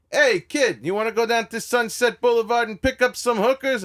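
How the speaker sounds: loud, high late-night phone holler; Andrew Dice Clay. Retold or imitated. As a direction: imitate a pushy comic rousing a friend. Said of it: "He'd go".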